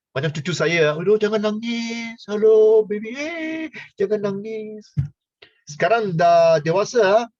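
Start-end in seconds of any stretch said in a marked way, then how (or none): 1.0-4.9 put-on higher baby-talk soothing; sing-song hush to the baby; cooing to a baby. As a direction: coo at a crying grandchild. Soothing crying grandchild.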